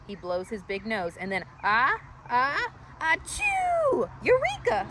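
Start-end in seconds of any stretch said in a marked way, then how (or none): 1.6-4.1 gasping ah-ah into a high achoo; building sneeze. Acted. Tiny sneezes with his big nose, building to a high achoo. 4.2-4.9 high, loud squeaky eureka. Acted. Marvin discovers the trunk idea, high and loud. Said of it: "squeaks Marvin".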